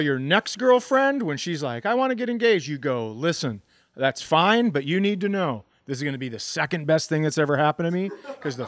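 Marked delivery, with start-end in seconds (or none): none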